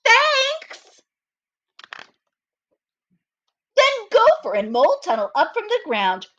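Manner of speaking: put-on slow, lively read-aloud for children; storybook reading. A narrator's reading. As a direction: read it like story time for little kids. Reads story narration aloud.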